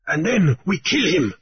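high, eager outburst; joyous cry. Acted. The goat man eagerly urges killing the pirate, high and gleeful. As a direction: blurt it out gleefully. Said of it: "the goat man cried joyously".

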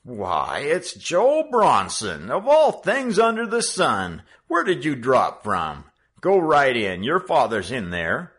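the clerk bursting out in recognition; astonished clerk. A narrator's reading. The head clerk suddenly recognizes Joe.

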